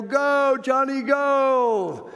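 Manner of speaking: high, stretched-out cheer from the stands; root for him like a sports fan. Retold or imitated. Parent at a sports event, high cheering.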